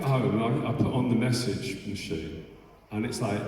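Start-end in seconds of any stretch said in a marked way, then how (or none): none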